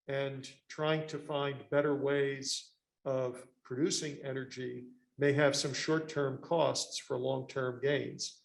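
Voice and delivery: ordinary talk, nothing marked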